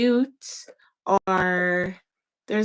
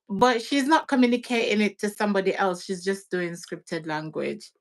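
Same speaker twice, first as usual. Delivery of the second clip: normal talking voice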